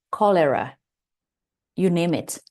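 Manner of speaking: normal talking voice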